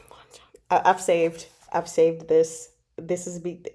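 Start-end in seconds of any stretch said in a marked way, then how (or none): 0.0-0.4 soft, fast sighing whisper; dreamy whispered sigh. Real, not acted. Fan swooning over Wan Cheng, whispered sigh. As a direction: sigh his name like a crush.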